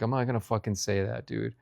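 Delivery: normal talking voice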